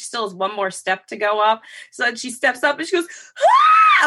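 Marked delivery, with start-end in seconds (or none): 3.4-4.1 loud high mock scream; imitated pain yelp. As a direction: yelp like Mom stepping on a hurt foot. Imitates mother's pain on stepping.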